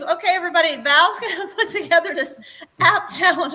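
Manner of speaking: rally the class like a fitness coach; upbeat trainer announcement. Retold or imitated. The trainer announcing the challenge to her followers, peppy and bright. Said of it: "She goes".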